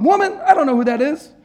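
high, loud, indignant brush-off; loud denial. Acted. Preacher relishing Peter's denial, loud and indignant. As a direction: fling the denial back at her. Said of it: "I just love".

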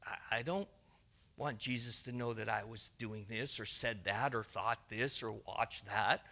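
very low, hushed confession; guilty private thought. Acted. Churchgoer thinking of what Jesus has seen, guilty hush. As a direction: say it under the breath, as if hiding it.

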